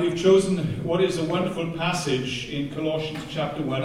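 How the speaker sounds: ordinary delivery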